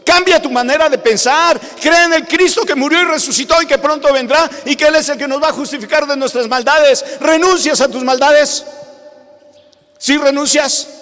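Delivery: fiery preaching. Acted. Pastor urging his listeners to renounce their wickedness, fiery preaching. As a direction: thunder it out from the pulpit.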